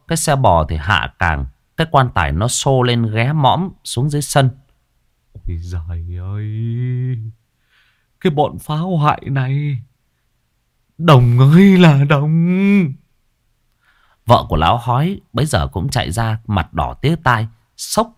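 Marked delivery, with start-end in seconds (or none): 5.3-12.9 despairing wail. A narrator's reading. Old man wails over ruined yard.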